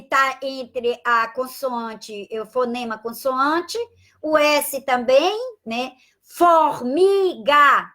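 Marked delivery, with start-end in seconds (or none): 6.2-7.9 "Formiga" stretched very slowly, each sound apart; slow demo word. Shown as a demonstration. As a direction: spell out "Formiga" by ear, slowly. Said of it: "o F, o R". ("Formiga" bem devagar, separando os sons)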